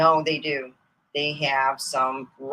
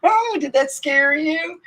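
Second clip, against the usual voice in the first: teasing; 12 semitones higher, 6 dB louder, slower than usual. Acted. Teasing scared museum visitor.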